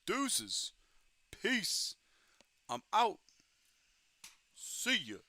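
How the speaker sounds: chirpy exit calls, loud; mock goodbye. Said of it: "It was just like".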